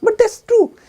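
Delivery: very high, loud protest; insistent objector. Acted. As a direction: argue back in disbelief. Objector insists, arguing back.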